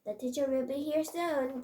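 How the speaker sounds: high, loud urging; urgent warning. A narrator's reading. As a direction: push them to hurry, punching "fast". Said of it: "Junta urged us".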